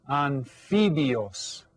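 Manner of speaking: "Anfibios" slowly in Spanish, an-FEE-byos; model pronunciation. Shown as a demonstration. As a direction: model "Anfibios" for learners, stretched out. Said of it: "Can you say that?".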